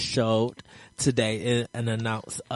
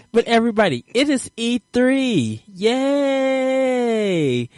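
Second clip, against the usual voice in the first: excited; 11 semitones higher, 5 dB louder, slower than usual. Real, not acted. Host cheers about E3 week.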